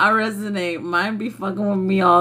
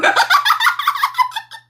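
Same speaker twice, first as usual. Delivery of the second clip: a real, high squeal of laughter; high shrieking laugh. Real, not acted. Laughing burst.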